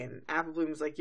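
ordinary talk

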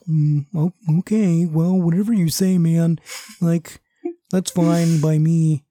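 a hint of Kermit the Frog, easygoing friend; lightly voiced laid-back sidekick. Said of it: "He's Kermit the Frog".

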